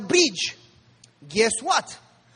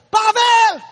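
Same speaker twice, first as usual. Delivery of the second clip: very loud, high retold scream; screamed name; 10 semitones higher, 8 dB louder than usual. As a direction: yell the name across to a companion. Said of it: "Benjamin screams to my father".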